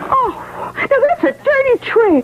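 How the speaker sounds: high, gasping outrage; gasp, then condemn it; shocked. Acted. [name] reacts to the murder story, shocked and high.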